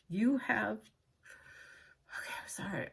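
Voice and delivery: breaking into a whisper mid-sentence; real tears cutting the words off. Real, not acted. Reading aloud to viewers, voice cracks into a whisper. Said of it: "I'm trying not to cry".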